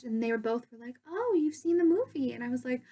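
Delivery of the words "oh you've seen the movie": imitated surprised reply, high and loud; high, delighted-sounding question; pleasant surprise. Two stars answering a young fan, surprised.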